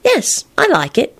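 high, slow, serene reply. A narrator's reading. Elizabeth answering Lupin about the music, serene high voice. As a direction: answer slowly and airily. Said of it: "she replied serenely".

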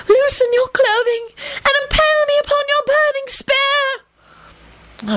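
high, slow, moaning nymph; wailing. Acted. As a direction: wail it out in rapture. Naiad voiced by narrator.

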